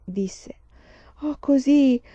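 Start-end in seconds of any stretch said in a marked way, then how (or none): 1.2-2.0 words swallowed by a huge yawn, higher and louder. A narrator's reading. Sleepy Laura telling mamma she's off to bed, yawning. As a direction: let a giant yawn break the line. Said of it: "le venne uno sbadiglio gigantesco".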